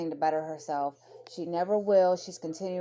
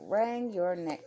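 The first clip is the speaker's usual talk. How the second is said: ordinary talk, nothing marked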